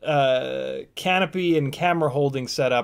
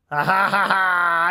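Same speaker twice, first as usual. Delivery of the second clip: triumphant laugh; 3 semitones higher, 5 dB louder, slower than usual. Real, not acted. Won against drone.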